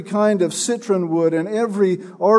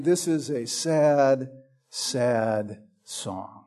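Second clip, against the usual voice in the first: slow, the second "sad" dropped much lower and softer; sorrowful; 5 semitones lower, 8 dB quieter, much slower than usual. Shown as a demonstration. Preacher showing how the repeated "sad" sounds, sinking sorrowfully. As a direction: let the second "sad" sink into grief. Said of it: "The second sad stresses the sorrow of it".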